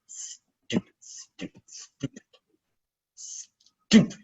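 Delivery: whispered under the breath; unvoiced self-directed whisper; muttered. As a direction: hiss it to yourself in frustration. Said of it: "George muttered to herself".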